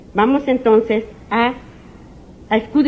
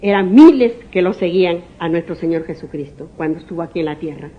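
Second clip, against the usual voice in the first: usual speaking voice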